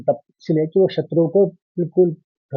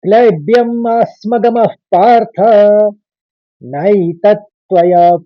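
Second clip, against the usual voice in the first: raised, measured Sanskrit chant; shloka recitation; 3 semitones higher, 4 dB louder than usual. Shown as a demonstration. Teacher joining the first two lines, chanted. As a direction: recite both lines as one verse. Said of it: "एक साथ सुनिए".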